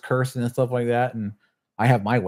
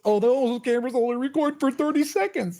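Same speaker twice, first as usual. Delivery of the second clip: mocking smug kid; 10 semitones higher than usual. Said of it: "he goes".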